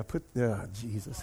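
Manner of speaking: ordinary delivery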